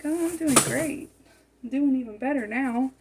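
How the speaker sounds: flirty reply. Pretend. Flirty reply to New York man.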